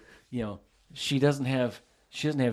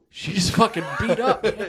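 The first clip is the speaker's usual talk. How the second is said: laughing joke; 6 semitones higher, 5 dB louder than usual. Real, not acted. Joking about her age, laughing.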